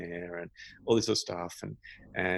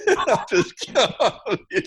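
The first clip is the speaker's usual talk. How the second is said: much higher, laughing between words; giggling disbelief, louder; 11 semitones higher, 8 dB louder than usual. Real, not acted. Laughing about being told to close his eyes.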